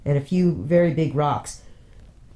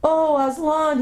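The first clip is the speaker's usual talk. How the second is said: much higher, joyful cry; 12 semitones higher, slower than usual. Acted. Boy crying out to the lion, joyful. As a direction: call out to him in joy. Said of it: "he cried".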